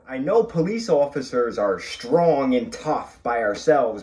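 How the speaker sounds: ordinary talk, nothing marked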